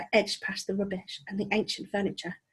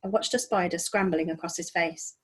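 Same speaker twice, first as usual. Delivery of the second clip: plain reading voice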